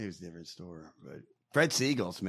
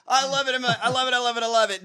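high, loud, rapid-fire delight; thrilled; 8 semitones higher, 4 dB louder, faster than usual. Real, not acted. Host delighted by the candidate's fire, thrilled. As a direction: gush it over and over.

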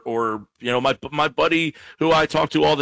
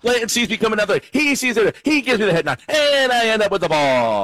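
play-by-play; 8 semitones higher than usual. Pretend. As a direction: call it like a live broadcast. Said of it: "Little play-by-play".

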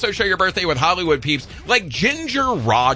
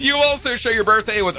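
ordinary talk

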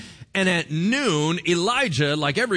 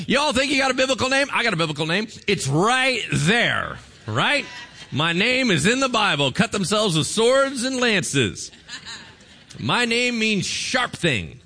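excited bragging; 7 semitones higher, 4 dB louder than usual. Real, not acted. Pastor excited his name is biblical.

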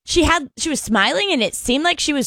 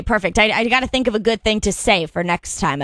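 normal talking voice

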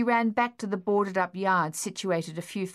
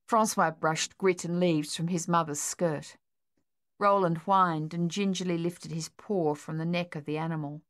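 usual speaking voice

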